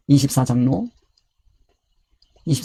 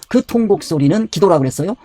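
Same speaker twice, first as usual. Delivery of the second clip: ordinary talk, nothing marked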